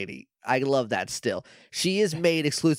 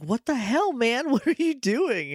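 startled outburst; 10 semitones higher than usual. Retold or imitated. Startled Z scolds Jack.

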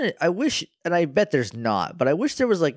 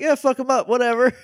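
high, put-on careless voice; mock callousness; 10 semitones higher, 3 dB louder, faster than usual. Mocking Vexen for giving up the one he made, mock callousness. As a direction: toss it off high, half laughing.

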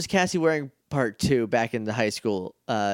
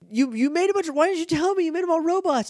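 higher, fast accusing; indignant question; faster than usual. Retold or imitated. Justin accusing the others, high and fast. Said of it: "And they're like".

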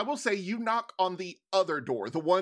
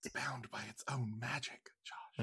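whispered aside; hushed, breathy whisper; 8 semitones lower, 14 dB quieter than usual. Real, not acted.

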